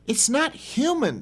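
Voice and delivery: high, slow woman's voice; lightly voiced shaken woman. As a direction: say it slowly, shaken. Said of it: "the woman says".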